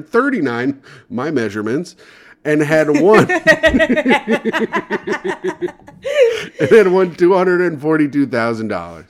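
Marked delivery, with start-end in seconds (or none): 2.4-9.1 reading through giggles; can barely get the words out laughing. Real, not acted. Laughing while reading the story.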